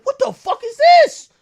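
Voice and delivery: high, loud, startled yelp of disbelief; shocked disbelief. Retold or imitated. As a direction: blurt it out as if you can't believe your eyes.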